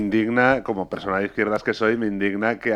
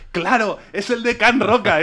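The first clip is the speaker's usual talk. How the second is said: much higher and slow, thrilled; 11 semitones higher, 3 dB louder, slower than usual. Retold or imitated. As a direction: savour the moment of recognition. Fan returns, thrilled.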